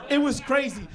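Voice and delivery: normal talking voice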